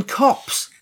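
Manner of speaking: high-pitched mock yell for the police; mock scream. Retelling a runaway captive's cry, mock scream. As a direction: yelp it high, without real volume. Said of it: "and starts screaming".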